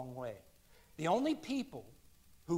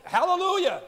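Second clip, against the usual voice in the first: Hallelujah shouted high and loud; 13 semitones higher, 14 dB louder than usual. Shown as a demonstration. Preacher showing the congregation the one word, shouted. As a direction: cry out Hallelujah in triumph. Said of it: "we either shout it or sing it".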